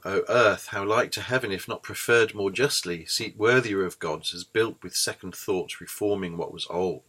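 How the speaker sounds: reading verse aloud with measured iambic lilt; poem recital. Shown as a demonstration. Lecturer reciting Milton to students, measured verse reading. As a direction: recite the Milton lines, giving each foot its beat. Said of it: "I have an example here".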